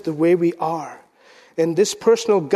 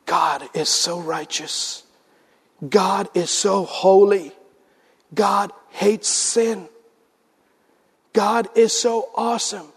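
awed realization; slower than usual. Acted.

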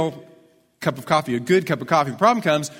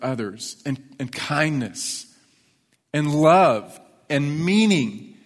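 preaching; much slower than usual. Acted. Slow reflective sermon climax.

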